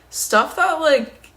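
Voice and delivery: mock a bratty child's jeer; childish taunt. Narrator mocking her own retorts as a child's taunt. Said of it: "a child would say".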